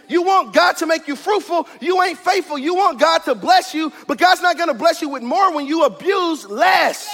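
insistent, rhythmic sermon delivery; impassioned preaching. Real, not acted. Preacher vowing to be faithful in the small, impassioned preaching. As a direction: drive each line home to the congregation.

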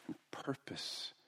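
softly whispered word. Real, not acted. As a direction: drop to a breath for this word. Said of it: "whispered word in sermon".

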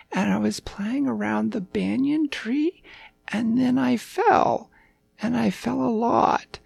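put-on high, childlike storytelling voice; earnest little puppy. A narrator's reading. Puppy recounting his adventure to the king, high and earnest. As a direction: ramble through it like a small high-voiced pup.

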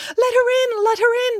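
very high-pitched piglet chorus; excited cry. A narrator's reading. Fooled piglets begging to open the door, excited cry. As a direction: squeal eagerly as little piglets. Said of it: "they all cried".

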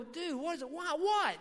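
high-pitched retold bafflement; mock incredulous questioning, rising high; baffled. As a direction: act out being thrown by the answer. Baffled reply to the clerk.

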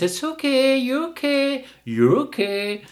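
put-on high, sing-song baby talk to the dog; mocking a doting dog owner's high coo. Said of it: "you do go like this".

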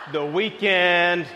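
slow, dreamy drawn-out weekend; longing. Acted. Preacher daydreaming to his church about the weekend, slow and dreamy. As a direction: sigh it out like a daydream.

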